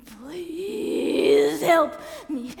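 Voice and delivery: long, loud, drawn-out plea; anguished cry. Acted. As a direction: wail it slowly. Climactic shouted plea.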